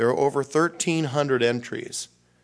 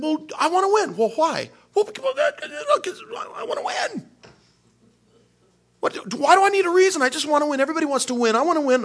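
very high, loud, whiny insistence; childish whining self, demanding to win; petulant inner voice; 7 dB louder, faster than usual. Acted. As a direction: whine it like a stubborn child. Acting out his inner argument.

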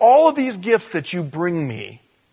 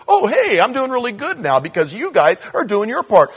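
high, perky send-up; hammed-up Tinkerbell-style God; mock revived God; 8 semitones higher, 4 dB louder than usual. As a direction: chirp it loud, mock-grateful and silly.

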